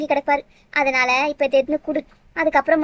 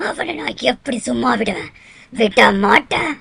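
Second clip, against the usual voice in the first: vengeful ghost; 4 semitones lower than usual. Acted. Ghost vows revenge.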